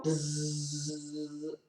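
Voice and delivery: long thin held mosquito buzz. Pretend. Reader showing a mosquito arriving in the story, buzzing. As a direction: buzz steadily like an approaching mosquito. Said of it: "here comes a mosquito".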